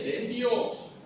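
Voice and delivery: normal talking voice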